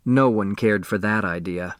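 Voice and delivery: ordinary delivery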